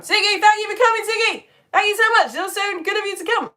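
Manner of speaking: high, loud gushing thanks; effusive calling out. Real, not acted. Teacher thanking Ziggy for coming, effusive calling out. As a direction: call out high to someone leaving.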